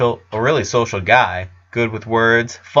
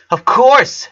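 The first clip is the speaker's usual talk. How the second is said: high and beaming; big smile; 13 semitones higher, 6 dB louder than usual. A narrator's reading. Harold welcoming his son's friend to lunch, smiling. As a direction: say it with a wide grin. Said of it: "with a big smile".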